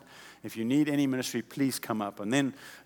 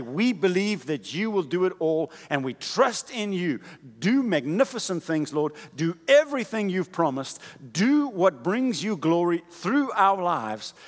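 loud, higher preaching prayer with ringing declarations; fervent prayer; 4 semitones higher, 6 dB louder than usual. Acted. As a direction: proclaim it like a rally, full force. Loud prayer against discouragement.

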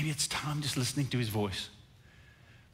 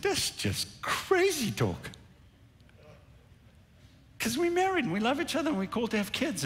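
retold incredulous woman; 11 semitones higher than usual. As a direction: act out her dismissal in a high voice. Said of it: "she looked at the doctor and said".

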